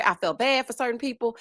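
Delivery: ordinary delivery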